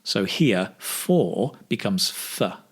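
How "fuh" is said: "f" as a short weak "fuh"; weak form of "for". Shown as a demonstration. Teacher showing learners how for becomes f, weak form. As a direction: reduce for to a weak "f". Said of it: "focus also on the weak forms".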